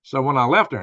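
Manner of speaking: normal talking voice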